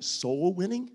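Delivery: rising, baffled question; puzzled question. Retold or imitated. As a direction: ask it like the phrase makes no sense. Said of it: "sounds like something that you practice on Halloween".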